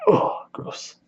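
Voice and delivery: breathy whispered ugh of disgust. Real, not acted. Reacting to the gross scene, whispered disgust. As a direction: recoil at the thought, voice barely there.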